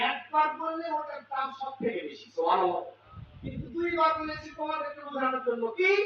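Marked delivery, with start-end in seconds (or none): none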